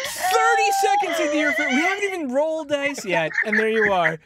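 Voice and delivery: high, loud mock wail; mock exasperation. Real, not acted. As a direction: whine at her in fake despair. Mock scolding the new player.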